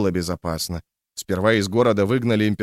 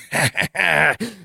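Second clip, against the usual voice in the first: high wild cackle to the sky; cackling hell torturer; 6 semitones higher than usual. A narrator's reading. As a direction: cackle like a madman. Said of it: "расхохотался". (безумный хохот)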